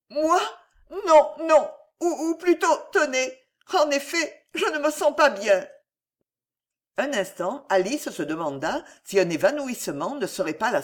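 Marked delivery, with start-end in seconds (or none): 0.1-5.7 high, halting, flustered voice; panicked faltering. A narrator's reading. Alice tells the count she feels unwell, panicked faltering. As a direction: falter high, denying then agreeing.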